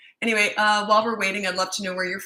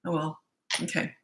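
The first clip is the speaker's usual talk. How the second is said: ordinary talk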